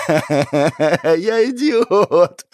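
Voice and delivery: high, drawn-out hysterical laughing cry; laughing through tears. A narrator's reading. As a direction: laugh helplessly into the words. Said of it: "Не прекращал смеяться парень со слезами на глазах".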